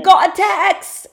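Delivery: put-on high, loud slowed British reality-show accent; mock British accent. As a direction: play the Love Island islander. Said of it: "in that accent".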